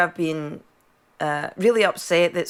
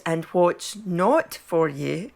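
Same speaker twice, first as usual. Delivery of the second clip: put-on lightly posh English accent, slow; mock a genteel Surbiton lady; slower than usual. Guest mocking the posh Surbiton version, posh English accent. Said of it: "the Surbiton version is".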